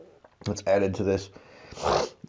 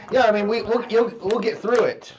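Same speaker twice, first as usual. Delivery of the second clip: strained high voice; 10 semitones higher, 3 dB louder than usual. Real, not acted. Reacting to the hot sauce.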